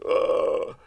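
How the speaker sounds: shocked matinee viewer groaning in disgust. Retold or imitated. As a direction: groan like you're repulsed. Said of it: "It was like".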